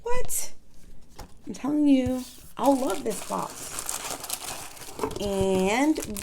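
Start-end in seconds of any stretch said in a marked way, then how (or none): 0.0-0.5 high-pitched, louder what; surprised. Real, not acted. Learning a free dashboard is included, high surprised what. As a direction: pop up in delighted disbelief.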